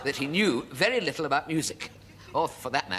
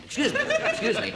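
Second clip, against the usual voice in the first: "Excuse me, excuse me" squeaked much higher and slow; a timid high squeak for attention; high squeaky plea; 3 dB louder than usual. Retold or imitated. Comic imitating himself at the shirt counter, high and timid. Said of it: "I gesture with a bare hand".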